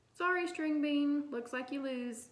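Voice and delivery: high, drawn-out sing-song jeer; taunting. A narrator's reading. Classmate jeering at the girl who lost the vote, taunting. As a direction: rub it in, loud and slow at first.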